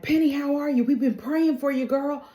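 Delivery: high, cooing imitation of concerned friends; fussing concern. Guests fuss over sick Penny.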